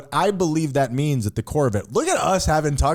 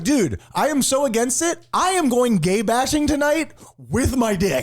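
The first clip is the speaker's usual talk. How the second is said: high-pitched comic hypothetical dude; 6 semitones higher than usual. Acted. Acting out a hypocrite for co-host, hammed-up bravado. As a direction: proclaim it high, as mock zealotry. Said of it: "what if you're like".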